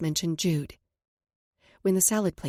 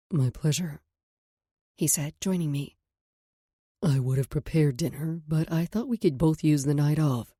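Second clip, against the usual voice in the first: lower, tender male voice; man's voice; 3 semitones lower than usual. Acted. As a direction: speak as a calm man.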